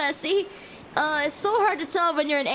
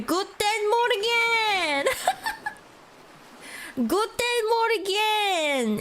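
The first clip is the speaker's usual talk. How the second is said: slow, higher hammed-up German greeting; mock German; 5 semitones higher, slower than usual. Guessing she is German, playful mock German greeting. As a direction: stretch out guten morgen playfully. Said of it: "Could have sworn she was German".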